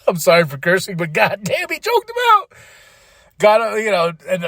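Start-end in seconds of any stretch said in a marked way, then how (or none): 1.7-2.5 very high excited squeal; high-pitched, incredulous hype; amazed outburst. Real, not acted. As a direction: exclaim it sky-high in disbelief. Amazed retelling of brutal choke.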